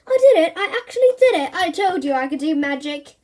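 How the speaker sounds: high, loud triumphant cry; thrilled shout. Acted. Boy amazed at his own magic, thrilled shout. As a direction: crow with disbelief and joy.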